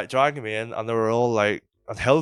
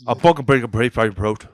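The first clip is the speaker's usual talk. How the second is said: louder, bubbly toothless threat; toothless mumble; 2 semitones higher, 10 dB louder than usual. Retold or imitated. Imitating the toothless bouncer's threat, mushy and loud. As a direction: garble the threat as if you had no teeth. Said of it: "That's the way it was".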